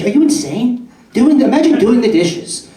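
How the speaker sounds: high, loud disbelief; incredulous. Acted. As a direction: act shocked someone does dishes. Mock outrage at roommate's chore.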